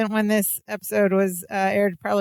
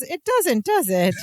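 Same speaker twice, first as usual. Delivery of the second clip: dawning realization; 6 semitones higher than usual. Retold or imitated. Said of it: "She goes".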